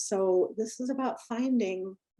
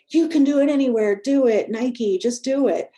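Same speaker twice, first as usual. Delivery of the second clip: louder, higher mock ad-slogan pep; hammed-up hard-sell wellness hype; mock pushy slogan; 5 semitones higher, 8 dB louder than usual. Poking fun at pushy wellness ads to the group, mock hype. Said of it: "put in our faces, like".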